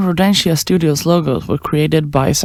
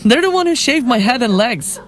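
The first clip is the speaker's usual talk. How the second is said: high-pitched, gleeful greeting; excited recognition; 8 semitones higher than usual. Acted. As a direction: leap up high with sudden delighted recognition. Condemned woman greets executioner brightly.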